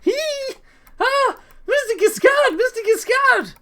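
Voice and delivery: shrill high squeal of an excited fan. Acted. Bard squealing at the famous opera star. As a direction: squeal her hero's name. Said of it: "there suddenly becomes a squee".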